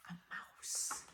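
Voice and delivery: whispered guess, no voice. Acted. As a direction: hush it like a secret.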